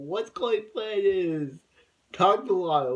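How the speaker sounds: stretched, rising-volume outburst; loud exclaiming. Real, not acted. Mock exclamation at Chloe's plan.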